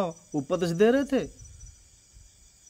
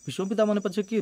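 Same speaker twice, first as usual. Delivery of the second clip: usual speaking voice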